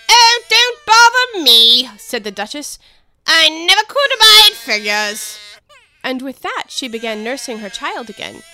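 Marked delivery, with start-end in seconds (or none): 0.1-1.9 loud, slow, haughty duchess; imperious plummy grande dame. Acted. Duchess voice held. 3.3-5.4 loud, slow, haughty duchess; imperious plummy grande dame. Acted. Duchess voice held.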